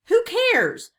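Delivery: loud, high roar from a pirate captain. A narrator's reading. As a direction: roar it out dismissively. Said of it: "roared Cap'n Bones".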